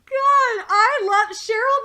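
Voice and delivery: high, loud burst of delight; excited gush. Real, not acted. Fan gushing about [name], excited burst. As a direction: blurt out how much you love her.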